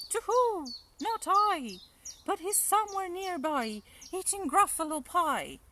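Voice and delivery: high, loud hooting owl. Acted. Owl answers the Gruffalo's child, high hooting. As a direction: hoot it out as a owl.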